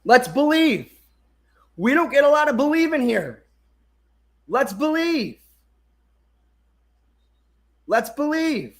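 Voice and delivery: high-pitched insistent exhortation, falling at each end; yelling. Real, not acted. Streamer pushing viewers to like and believe, yelling. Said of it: "I'm yelling. I'm yelling at you".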